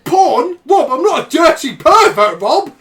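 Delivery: loud, high, mock-offended; indignant yelling. Real, not acted. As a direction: protest loudly to Rob. Indignant outburst at friend.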